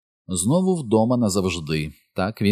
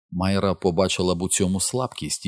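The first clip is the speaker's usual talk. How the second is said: ordinary delivery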